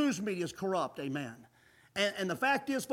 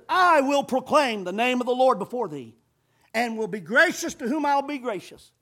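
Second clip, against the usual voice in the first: loud proclaiming of God's words; preacher voicing God's promise; preaching scripture; 8 dB louder than usual. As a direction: declare it to the congregation.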